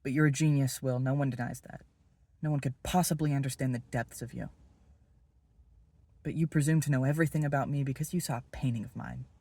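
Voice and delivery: a grudge finally spilling out; rising anger. Acted. Confronting Will about the painting.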